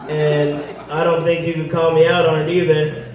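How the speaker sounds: ordinary talk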